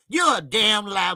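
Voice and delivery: normal talking voice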